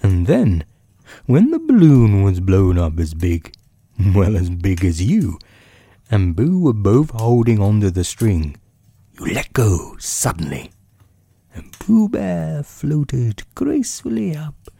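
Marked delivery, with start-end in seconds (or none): none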